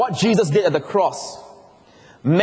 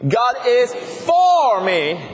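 "for" hit higher and louder; stress on for; 6 semitones higher, 3 dB louder, much slower than usual. Shown as a demonstration. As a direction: raise the voice on "for". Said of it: "and then for".

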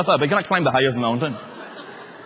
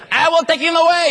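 high, loud insistent vow; Mary's vow; 12 semitones higher, 4 dB louder than usual. Acted. Preacher voicing Mary's resolve to take Jesus, high and loud. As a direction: insist you'll carry him yourself. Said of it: "and she alone can".

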